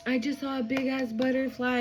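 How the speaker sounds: normal talking voice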